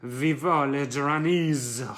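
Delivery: a bad, jokey French accent on purpose; mock French accent. Fan toasting Duranis in a hammed-up French accent. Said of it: "Never, never attempt a French accent".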